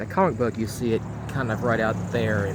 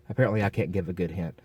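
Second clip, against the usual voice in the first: ordinary talk, nothing marked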